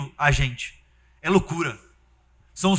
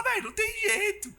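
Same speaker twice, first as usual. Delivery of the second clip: high, laughing imitation; laughing mock voice; 7 dB quieter than usual. Preacher mocks church sister's words, laughing high. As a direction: giggle through the quote.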